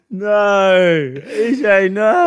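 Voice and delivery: high, loud, drawn-out no; mock disbelief. Real, not acted. Friend hears the butter chicken story, stunned high no.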